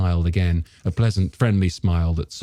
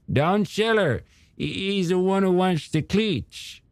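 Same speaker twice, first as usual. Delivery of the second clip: put-on old shopkeeper character; 12 semitones higher than usual. Storekeeper grumbling to a customer about the beaches, high character voice. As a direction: play a hesitant, opinionated storekeeper, pitched up.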